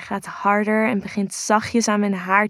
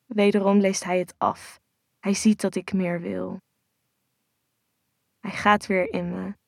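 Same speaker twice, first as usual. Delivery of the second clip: ordinary delivery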